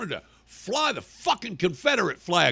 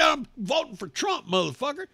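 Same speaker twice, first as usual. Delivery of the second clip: defiant reply; 11 semitones higher than usual. Retold or imitated. Acts out his texted reply.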